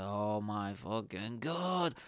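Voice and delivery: slow, softened groaning dread; mortified. Retold or imitated. Comedian standing there embarrassed, slow mortified mutter. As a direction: drag it out, sinking with embarrassment. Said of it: "so embarrassed".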